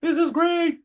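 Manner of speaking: high, squealing thrill; excited. Acted. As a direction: gush like a nervous beginner who just got her first deal. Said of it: "She's excited, she's nervous, she's like".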